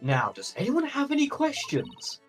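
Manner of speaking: high professorial Viking voice. Acted. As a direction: ask the class like a grand professor. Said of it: "what Viking voice do I give him".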